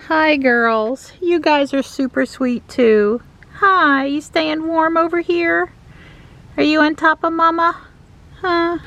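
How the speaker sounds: high pet talk. Real, not acted. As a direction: coo sweetly at the pets. Greeting the cats.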